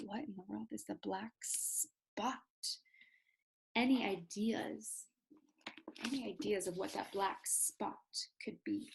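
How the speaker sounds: soft, hushed, suspenseful teacher voice; teacher whispers a mystery question to the class; mysterious hush. Shown as a demonstration.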